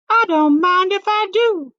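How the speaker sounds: put-on high cheeky girl; Goldilocks helping herself. A narrator's reading. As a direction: help yourself with cheeky glee. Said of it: "said Goldilocks".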